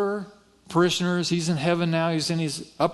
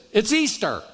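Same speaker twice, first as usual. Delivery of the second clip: higher-pitched mock dismay; mock exasperation; 4 semitones higher than usual. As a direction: exclaim it in retold exasperation. Retold exasperation at Easter timing.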